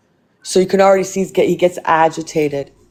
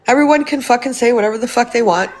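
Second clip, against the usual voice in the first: ordinary talk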